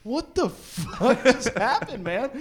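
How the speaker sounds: stunned and cracking up; laughing disbelief. Retold or imitated. Tourists reacting to the host, laughing in disbelief. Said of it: "You're like".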